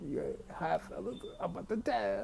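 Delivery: nervous wheeze to the fellas; squeaky mock voice. Scared patient greeting the guys in the ambulance, high squeak.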